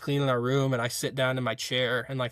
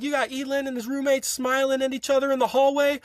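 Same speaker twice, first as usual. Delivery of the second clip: high, accusing imitation; mock scolding instructor; 11 semitones higher than usual. Instructors blaming two smiling roommates before everyone, mock scolding. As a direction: announce the offense in a pitched-up mock voice.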